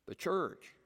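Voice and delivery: usual speaking voice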